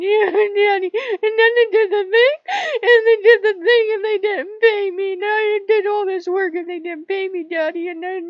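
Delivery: high, loud whiny mock bawling; crying and wailing. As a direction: wail your complaint like a child running to a parent. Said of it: "And I was crying and wailing".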